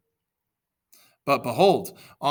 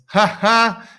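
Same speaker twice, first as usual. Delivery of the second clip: loud, high, put-on mocking "ha ha"; mocking laugh; 11 semitones higher, 13 dB louder than usual. A narrator's reading. Achilles laughing down at the Trojans, loud high mock laugh. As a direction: cackle at them in scorn. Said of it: "Achilles again mocked the Trojans".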